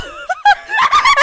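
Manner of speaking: high shrieking laugh; loud laughter. Real, not acted. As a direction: burst out cackling. Bursts out laughing.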